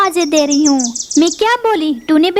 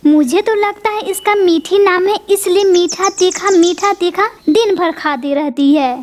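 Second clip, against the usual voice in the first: ordinary talk, nothing marked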